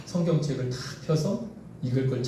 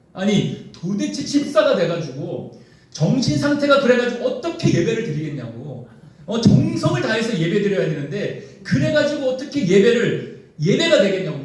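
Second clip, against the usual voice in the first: raised-pitch husband's telling-off; acted scolding; 5 semitones higher, 5 dB louder than usual. Husband berating his wife over an unironed shirt, acted scolding. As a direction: lecture the wife in mock exasperation. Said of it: "막 아내를 혼내는 거예요".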